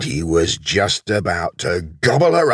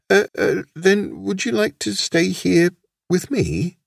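high, tentative asking; hesitant invitation; 10 semitones higher than usual. A narrator's reading. Wolf inviting the lamb to stay, hesitant. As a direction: stumble in, then ask in a raised voice.

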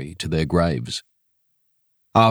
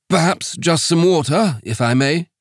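higher, courteous voice; polite missionary; 8 semitones higher than usual. Acted. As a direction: reverend politely declining whiskey.

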